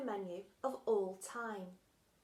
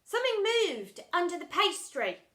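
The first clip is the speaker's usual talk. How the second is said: alarmed; 9 semitones higher, 9 dB louder than usual. Acted. Girl alarmed.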